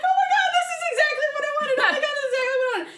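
high, fast excited squeal; giddy gushing. Retold or imitated. Bride seeing her wedding setup for the first time, giddy squeal. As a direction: rush it out, thrilled and giddy.

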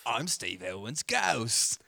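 slow, loud Australian ghost; Steve Irwin. Acted. Friend joins bit as naturalist's ghost, slow loud Australian. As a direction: drawl out a spooky mock Australian accent.

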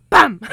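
sudden explosive, high "bam"; hammed-up loud bang; door slam imitation. Imitating the door after sneaking home drunk, explosive bang.

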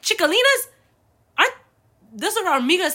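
can't believe that's a word; incredulous. Retold or imitated.

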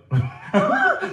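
squeals of laughter at his joke; loud high laugh; high burst of laughter. Real, not acted.

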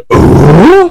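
high, loud strained noise for a face; mock strained groan; imitated strained grunt. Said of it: "had that".

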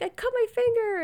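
high, whiny mock-hurt voice; pretend hurt. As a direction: play up the injury. [name] fakes the injury.

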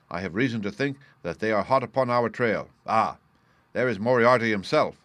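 ordinary delivery, nothing marked

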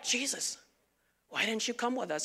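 soft aside imitating puzzled parents; hushed retold question. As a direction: ask it low, under your voice. Parents retold finding young Jesus.

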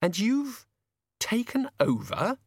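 high-pitched disbelieving question; Susan, incredulous. A narrator's reading.

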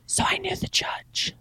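whispered smug aside. Real, not acted. Bragging about knowing the judge, soft whisper. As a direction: lean in and whisper the secret.

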